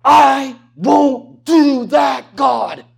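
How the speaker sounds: loud defiant shout; mock defiant yell at God. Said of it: "at God and yell".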